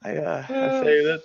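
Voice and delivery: laughing through the words; giggling while talking. Real, not acted. Laughing after the joke.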